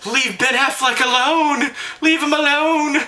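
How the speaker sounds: high, slow, pleading mock rant. Defending [name] like the viral fan, high slow pleading. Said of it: "that old [name] video".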